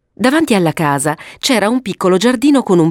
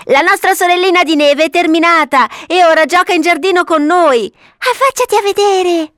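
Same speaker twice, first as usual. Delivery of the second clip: put-on high, excited childlike calling; children's shout; 11 semitones higher, 3 dB louder than usual. Children calling their mother to the window, shouting high. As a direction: announce it proudly at high pitch.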